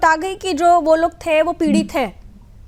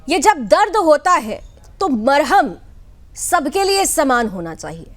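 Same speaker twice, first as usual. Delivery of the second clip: slow, deliberate opening to a pointed question; slow, grave delivery; 2 semitones lower, slower than usual. Real, not acted. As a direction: weigh each phrase slowly. Anchor's grave opening before question. (धीमे, गंभीर अंदाज़ में)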